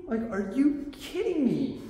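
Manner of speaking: real frustration repeated slowly; slowed, drawn-out disbelief. Real, not acted. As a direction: stretch it out, worn down and incredulous. Venting about the power outage.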